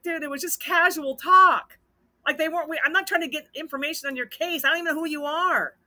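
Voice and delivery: high, loud, insistent imitation of protesting; retold exasperation. Husband sets the accusing kid straight, exasperated protest. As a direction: insist on innocence, acting out the exasperation.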